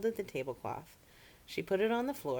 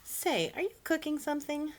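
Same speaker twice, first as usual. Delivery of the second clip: curious cousin; 11 semitones higher than usual. Acted. Alcaloo smells cooking.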